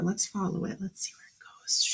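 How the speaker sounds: fast soft whisper of curiosity; hushed whisper. A narrator's reading. Teacher leading the class to follow the tear, whispering. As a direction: whisper it as you tiptoe after the tear.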